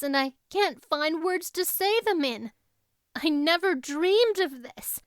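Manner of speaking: put-on a girl too full of joy for words; dreamy girl pouring out her thoughts; eager young Anne. Anne's voice in dialogue.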